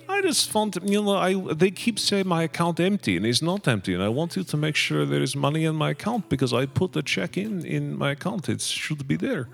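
high-pitched Russian student with broken English; pleading Russian student, imitated; imitating a Russian accent.

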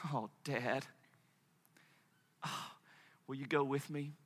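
put-on very soft, hesitant child's request; small, tearful plea. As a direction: ask Dad timidly, with pauses. Tearful son asks father.

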